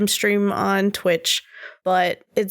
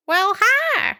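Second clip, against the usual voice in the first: Southern cat voice; 13 semitones higher than usual. Pretend. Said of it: "Southern cat voice".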